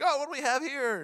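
high, mock-curious discovery; mock surprise. Dad checking the rental bookshelf, mock curious. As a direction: pretend to find something unexpected. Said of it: "I'm like".

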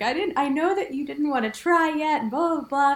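mock-tearful high pitch; retold tearful. Wife retelling her tearful words to husband, mock-crying. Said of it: "I started crying 'cause I was like".

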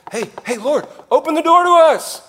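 high, loud pleading call; desperate cry to be let in; calling at the door. Acted. Virgins calling at shut door.

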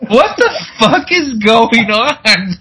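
high, slow, laughing what-the; can't believe it, laughing; laughing disbelief. Real, not acted. Retelling the movie to co-host, laughing disbelief. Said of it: "laughing disbelief".

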